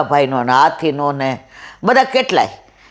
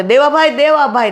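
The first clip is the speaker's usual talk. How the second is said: crowd calling out; 7 semitones higher than usual. Retold or imitated. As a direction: call out in alarm as the elephant charges. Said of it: "બધાય બૂમ પાડે".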